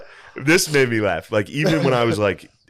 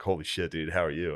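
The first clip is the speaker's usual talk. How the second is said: hushed concern; 5 semitones lower, 9 dB quieter than usual. Retold or imitated. Friend checking on him after trip.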